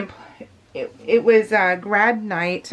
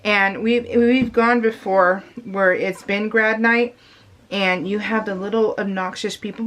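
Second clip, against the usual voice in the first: normal talking voice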